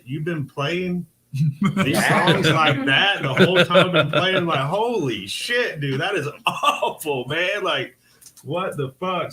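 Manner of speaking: high, drawn-out, half-laughing outburst; appalled disbelief. Retold or imitated. Telling of bassist exposed in studio, appalled retold outburst. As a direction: act out loud, incredulous horror at a bandmate. Acted reaction to bassist's wrong lines.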